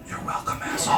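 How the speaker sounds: whisper in the ear. Retold or imitated. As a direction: hiss it three inches from his ear. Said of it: "I slowly creep in behind you".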